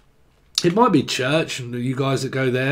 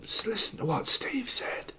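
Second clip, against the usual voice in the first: whisper; 7 semitones higher, 11 dB quieter than usual. Real, not acted. Aside hushing about Steve.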